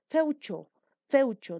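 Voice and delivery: "Feucho" with a strong, emphatic first part; clear word demo. Shown as a demonstration. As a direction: model "Feucho" firmly, voice falling at the end. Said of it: "Tres pronunciaciones o intensidades".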